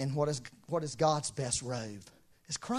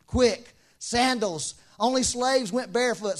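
louder, commanding hurry; urgent order; 6 semitones higher, 6 dB louder, slower than usual. Acted. Father ordering servants to fetch sandals, urgent. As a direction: rush the servants.

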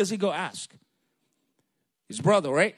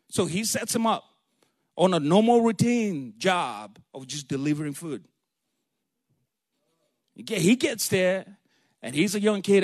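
usual speaking voice

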